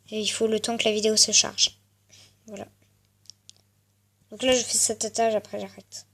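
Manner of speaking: normal talking voice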